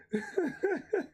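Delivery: real high-pitched giggling fit; high laughter. Real, not acted. Streamer cracking up at his own jargon, laughing. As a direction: break up laughing, high and helpless.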